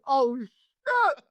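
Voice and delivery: high, near-crying mock dismay; dismayed panic. Promo man reacts to being insulted, high crying dismay. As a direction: whimper it like the worst insult ever. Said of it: "I was like".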